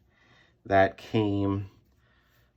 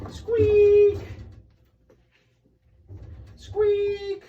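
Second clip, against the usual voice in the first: very high, louder squeak bursts; louder mock squeak; 6 dB louder than usual. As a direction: play the press creaking hard, high and loud. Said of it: "get ready for some sound effects".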